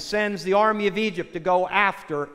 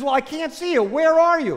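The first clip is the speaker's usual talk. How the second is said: high, raised acted call; searching call; 9 semitones higher, 4 dB louder than usual. Husband looking for unseen stranger, acted calling out. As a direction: call out to find someone.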